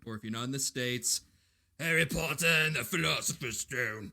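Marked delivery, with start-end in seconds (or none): 1.8-4.1 put-on British accent. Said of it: "It's a terrible accent".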